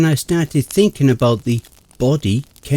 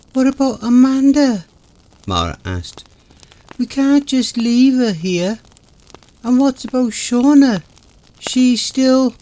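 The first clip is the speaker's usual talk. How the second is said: distressed plea; 11 semitones higher than usual. Acted. Girlfriend pleading to stay.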